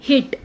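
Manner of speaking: हिट with a short, clipped i; short vowel hit. Shown as a demonstration. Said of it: "ये word है H I D". (छोटी इ के साथ हिट)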